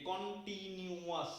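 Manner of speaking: Continuous slowly split into four syllables. Shown as a demonstration. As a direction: count out কন-টি-নিউ-য়াস as separate beats. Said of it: "চারটা syllable".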